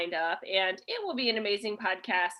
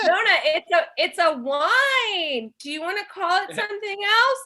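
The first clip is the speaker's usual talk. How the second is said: mock exasperated pleading; 8 semitones higher, 5 dB louder than usual. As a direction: plead with Nona, stretching the key word. Pleading with old Nona in story.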